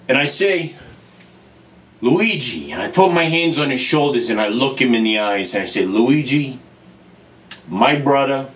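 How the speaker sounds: low, measured, heartfelt; solemn and sincere. Acted. Plumber gravely addressing his brother Luigi, solemn and low.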